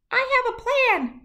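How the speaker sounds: a tiny shrew proposing teamwork. Acted. Shrew offers New to work together, tiny high voice.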